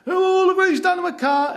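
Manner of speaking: high, loud imitation of an upset driver; mock yelling. Upset driver yelling at the deer over his dented car, mock yelling. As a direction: wail it out in a strained high yell. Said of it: "he's yelling at the deer".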